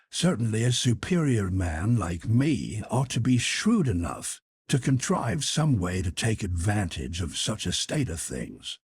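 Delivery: ordinary delivery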